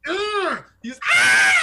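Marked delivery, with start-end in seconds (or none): 0.1-0.6 high, loud in-your-face ugh; sudden snarl at the cowering man; menacing grunt. Acted. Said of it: "show up in his face and go". 1.1-1.5 loud frightened scream; terrified NPC screams. Acted. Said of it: "and he just screams".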